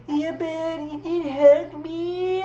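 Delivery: high, slow, whiny pout about not getting a hug; whiny Vanessa. Retold or imitated. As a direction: drag out the complaint like a hurt child. Vanessa still complaining.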